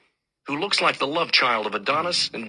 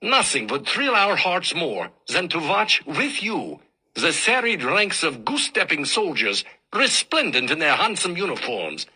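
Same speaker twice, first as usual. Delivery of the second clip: a hint of a lecturing German professor, German accent; lightly voiced old German teacher in German accent; thick German accent; 4 semitones higher than usual. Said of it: "German accent so thick".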